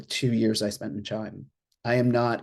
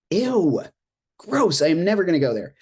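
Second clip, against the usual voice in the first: disgusted; 5 semitones higher than usual. Retold or imitated. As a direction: squeal it like a snobby teen. Mocking former self, disgusted.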